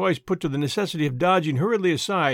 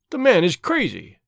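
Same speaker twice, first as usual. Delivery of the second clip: a touch louder, mock exclamation; mildly raised exclaim; 3 semitones higher, 5 dB louder than usual. A narrator's reading. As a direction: blurt it out, lightly raised. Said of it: "Harry exclaimed".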